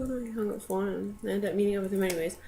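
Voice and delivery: ordinary talk, nothing marked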